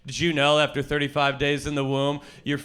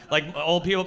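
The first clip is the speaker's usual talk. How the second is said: ordinary talk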